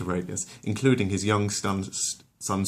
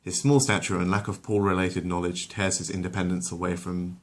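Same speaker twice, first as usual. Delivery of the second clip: ordinary delivery, nothing marked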